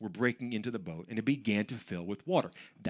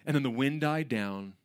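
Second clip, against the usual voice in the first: ordinary delivery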